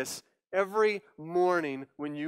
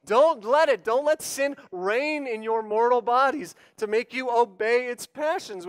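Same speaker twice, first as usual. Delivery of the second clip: ordinary talk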